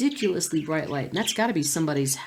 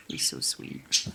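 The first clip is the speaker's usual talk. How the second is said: whisper; 5 dB quieter, slower than usual. Real, not acted.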